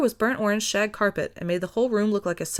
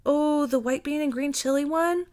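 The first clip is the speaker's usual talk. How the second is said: puppy dog plea; 7 semitones higher than usual. A narrator's reading. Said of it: "put on his puppy dog face".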